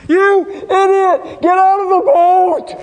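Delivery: high, drawn-out distant-hailing shout; imitated frantic cousin bellowing; yelling from shore. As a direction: holler slowly across the water. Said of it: "Eric was yelling from the".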